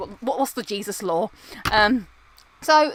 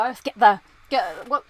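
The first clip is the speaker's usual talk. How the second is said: usual speaking voice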